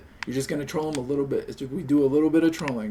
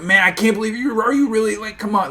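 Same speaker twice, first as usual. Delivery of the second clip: high, loud mock outrage; a hammed-up little freak out; 8 semitones higher, 8 dB louder than usual. Streamer demoing a fake breakdown to the boss, mock outraged. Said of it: "just kinda do a little freak out".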